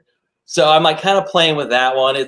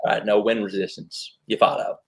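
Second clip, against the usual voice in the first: Matthew McConaughey; 3 semitones lower, 4 dB quieter than usual. Acted. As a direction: lean back and purr it like McConaughey.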